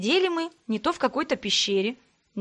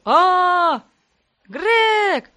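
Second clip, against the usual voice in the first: loud high scream while falling; 8 semitones higher, 8 dB louder than usual. A narrator's reading. Brother screaming while falling into darkness. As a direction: scream as you fall into the dark. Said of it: "продолжил".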